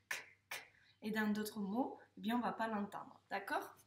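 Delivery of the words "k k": "k" as a bare voiceless click; isolated k sound. Shown as a demonstration. Teacher isolating the sound for a pupil, bare "k". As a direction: say "k" alone, no vowel after it. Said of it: "le son k".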